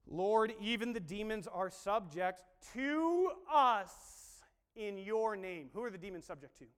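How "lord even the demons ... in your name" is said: "to us" stretched long and loud; "to us" punched, "in your name" easing after; stress on "to us". Shown as a demonstration. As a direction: draw out "to us" slowly and emphatically. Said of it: "Notice what they said".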